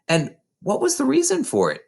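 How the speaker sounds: puzzled wonder. A narrator's reading. Matilda's puzzled inner question.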